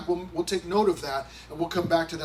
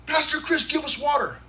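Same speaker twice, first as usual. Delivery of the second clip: high, louder mock demand; demanding crowd; 8 semitones higher, 3 dB louder than usual. Pastor imitating his church demanding water, high mock whine.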